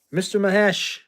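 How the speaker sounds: loud umpire's out call. Retold or imitated. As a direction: yell it like an umpire ejecting a player. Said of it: "to quote the baseball player".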